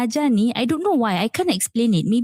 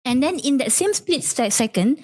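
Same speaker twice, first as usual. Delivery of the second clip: ordinary delivery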